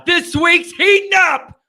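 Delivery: sudden loud, high burst of hype; hyped. Real, not acted. Host opening rivalry game week, hyped.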